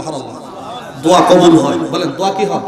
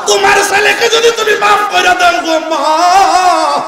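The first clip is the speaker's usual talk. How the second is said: high chanted cry, long-held ending; impassioned plea; 10 semitones higher than usual. Retold or imitated. Prophet begging the mother to forgive her son, high chanted plea. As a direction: beg at full preaching pitch. Same words cried out.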